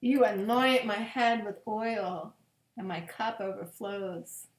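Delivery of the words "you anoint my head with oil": ordinary delivery, nothing marked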